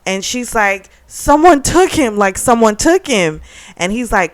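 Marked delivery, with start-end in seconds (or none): 1.1-3.4 frantic, higher and louder; mock-distraught caller raising the alarm; acted panic. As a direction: blurt it out in alarm, hitting "took". Frantic caller reporting a kidnapping.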